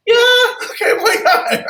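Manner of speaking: high, breezy client voice; high agreeable client. Retold or imitated. Client agreeing to be open-minded, high breezy voice. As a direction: shrug it off as a happy client. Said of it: "And then they'll go".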